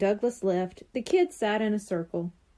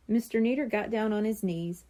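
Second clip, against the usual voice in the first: usual speaking voice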